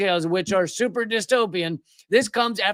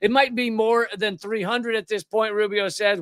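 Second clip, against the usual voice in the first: ordinary talk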